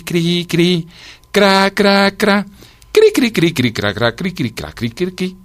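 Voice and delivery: high, scraping cri-cra imitation; high chirps in uneven bursts; cicada chirping. A narrator's reading. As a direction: imitate a cicada sawing away at its song. Imitating the cicada's guitar song.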